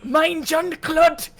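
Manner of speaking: mock German, slow on Mein Jean-Claude; German accent. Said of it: "We went real German".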